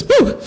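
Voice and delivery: sharp high-pitched yelp at the cold swab; high startled whoop. Retold or imitated. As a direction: squeak it out like a startled old lady. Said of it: "she goes".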